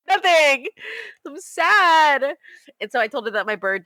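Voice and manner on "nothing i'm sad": mock upset. Own upset answer acted out.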